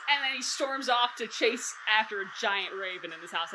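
normal talking voice

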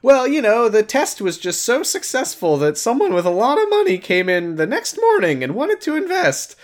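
bright cheerful computer voice; high robot assistant voice. Retold or imitated.

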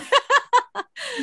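bright high giggle; high laugh. Real, not acted. Laughing after doctor quote.

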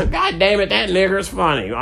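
high, loud mock biker at the bar. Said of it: "I'm like".

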